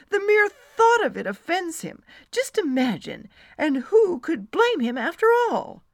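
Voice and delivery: high, loud, indignant rant; worked up and incredulous, voice swooping up. Acted. Friend rants about her offended husband, loud and indignant. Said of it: "she ranted".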